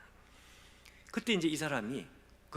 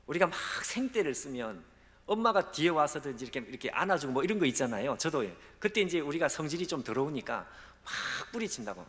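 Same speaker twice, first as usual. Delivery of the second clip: normal talking voice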